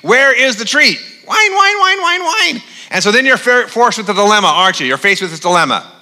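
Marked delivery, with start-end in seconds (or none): 0.0-1.0 higher-pitched, insistent begging pet; demanding whining dog. Acted. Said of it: "yipping and whining, you know, wanting that treat". 1.2-2.6 very high, squealed pestering; mock dog whining. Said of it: "yipping and whining".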